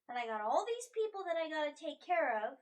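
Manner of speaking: loud, worked-up frustration; overwhelmed protest. Acted. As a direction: let the responsibility spill out loudly. In-character overwhelmed by responsibility.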